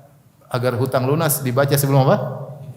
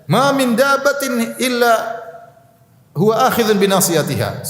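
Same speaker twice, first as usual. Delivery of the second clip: proclaiming the verse high and slow, defiant; Prophet Hud defiant; 8 semitones higher, slower than usual. Acted. Hud defying the gathered crowd, slow and high proclamation. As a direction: declare it to the crowd as Hud would, unafraid. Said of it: "Nabi Hud berkata kepada mereka".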